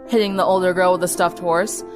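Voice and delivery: ordinary delivery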